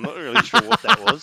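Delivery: cracking up while getting the words out; laughing. Real, not acted. Laughing at a bad accent.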